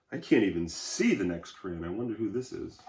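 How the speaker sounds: plain reading voice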